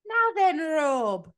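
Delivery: high, bright Scottish-ish TV host voice gone Irish; chirpy breakfast-TV host, badly done; awful Lorraine Kelly impression. [name] mocking a chirpy morning TV host. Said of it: "That's an awful impression".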